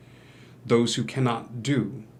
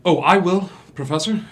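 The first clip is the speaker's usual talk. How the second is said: eager volunteer; 6 semitones higher, 7 dB louder, faster than usual. Acted. Eager student volunteers first.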